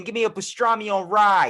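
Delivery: broad Boston accent impression. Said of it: "Somebody from Boston".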